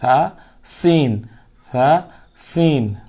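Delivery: slow সা then সিন, set side by side; slow contrasting সা and সিন; slow contrast sa and sin. Shown as a demonstration. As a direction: drill সা against সিন slowly. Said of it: "সা এর বিপরীত".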